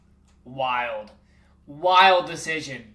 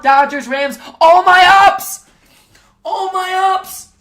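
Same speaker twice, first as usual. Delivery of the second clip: much higher, loud, slow chant-like yell; hype shout; 11 semitones higher, 9 dB louder, slower than usual. Real, not acted. Hype chant about his teams.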